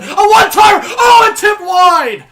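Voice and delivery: loud high yell, drawn out on the miss; near-goal groan-shout; excited shout. Real, not acted. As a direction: scream the one-timer. Shot tipped wide, live call.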